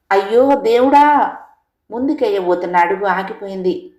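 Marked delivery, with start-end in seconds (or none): 0.1-1.4 loud, slow wail of horror; a mother's anguished outcry, drawn out; anguished cry. Retold or imitated. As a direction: cry out to God in shock. Mother reliving husband's death.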